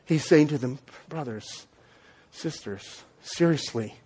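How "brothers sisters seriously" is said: soft, low, tender address; compassionate appeal. Retold or imitated. Paul to his Galatian friends, soft compassionate plea. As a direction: hush it like a caring father. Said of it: "in this compassionate way".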